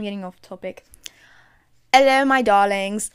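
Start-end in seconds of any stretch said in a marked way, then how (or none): 1.9-3.1 put-on loud, theatrical hello in an affected accent; booming affected-accent greeting; too-loud greeting. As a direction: blare out a grand greeting, much too loud. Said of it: "that was so loud in my ears".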